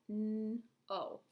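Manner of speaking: N-O as separate sounds, "nnn", then "oh"; sounding out. Shown as a demonstration. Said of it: "What sounds do we hear".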